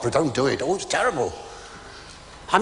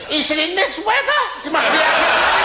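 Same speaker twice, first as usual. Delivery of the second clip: high, louder outraged retort; indignant; 13 semitones higher, 5 dB louder than usual. Retold or imitated. Bride refusing her husband in the storm, mock outrage. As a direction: scoff at the idea, laughing.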